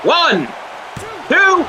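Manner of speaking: ringside play-by-play; wrestling commentator. Acted. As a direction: call the action like a wrestling broadcast.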